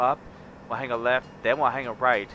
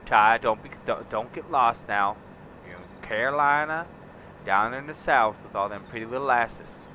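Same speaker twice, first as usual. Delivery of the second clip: southern ranch talk; 3 semitones higher than usual. Acted. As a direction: lay on a big Southern accent, welcoming a partner. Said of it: "I could go all southern on you".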